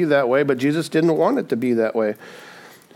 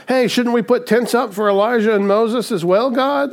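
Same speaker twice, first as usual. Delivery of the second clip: a hint of eager blurting; 8 semitones higher than usual. Peter retold at transfiguration.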